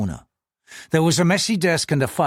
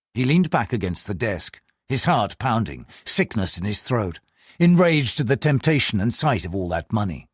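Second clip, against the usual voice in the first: ordinary delivery, nothing marked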